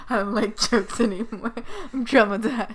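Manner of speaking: loud, laughing protest; laughing mock trauma. Teller joking to friend about the humourless customer, mock traumatized. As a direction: joke through the laughter.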